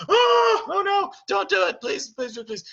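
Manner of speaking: high shouted pleading, fading; panicked castle staff. Acted. Staff begging raging Beast to stop, panicked. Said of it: "that's like a".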